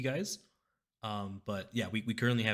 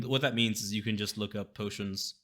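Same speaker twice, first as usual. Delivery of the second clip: usual speaking voice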